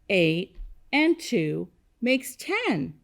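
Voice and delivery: slow, word-by-word recital; leading choral repetition. Shown as a demonstration. Teacher leading kindergartners to say it with her, slow choral pace. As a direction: space out each word so all can join. Leads class in saying it together.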